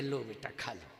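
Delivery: very soft, low imitation of mother; retold mother. Preacher's mother offering him laddus, softly imitated. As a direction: offer it gently, low and hushed. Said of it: "तो तुरंत कहती है".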